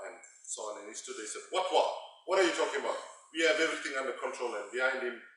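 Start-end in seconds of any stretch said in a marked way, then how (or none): 1.5-4.5 imitating an indignant official, higher and louder; mock spokesman's emphatic denial; blustering denial. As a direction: wave off the question with loud, incredulous confidence. Acts minister's denial at press conference.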